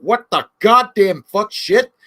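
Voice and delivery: loud, slow stunned outburst; outraged disbelief. Retold or imitated. To a friend, acting shock at the charges, loud slow outburst.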